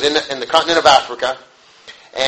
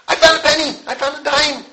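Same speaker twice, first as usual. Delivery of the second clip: put-on much higher, a child squealing with pride; excited kid; 10 semitones higher than usual. Preacher imitating kids who found a penny, high and excited. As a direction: chirp it like a happy child.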